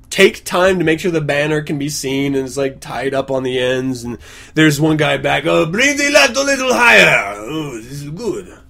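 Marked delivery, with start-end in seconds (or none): 5.5-8.6 put-on accent sliding from Mexican into Russian; botched Mexican accent. Gang member directing banner hanging, botched Mexican-Russian accent. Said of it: "that was a little Russian, not so much Mexican".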